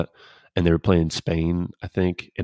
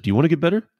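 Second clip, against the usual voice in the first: challenging partner; 10 semitones higher, 4 dB louder, much faster than usual. Retold or imitated. Partner challenges young pitcher.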